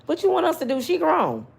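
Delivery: high dismissive cop voice; mock police brush-off; voicing the police. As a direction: brush it off like a bored officer. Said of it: "They like".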